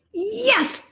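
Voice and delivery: high, triumphant cheer; a bright yelp of victory. Acted. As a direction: cheer it like a little monster who won. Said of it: "cheered Leonardo".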